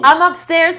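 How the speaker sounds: loud call from upstairs; yelling across the room. Real, not acted. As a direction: tell him where you are, loudly. Calling to husband in another room.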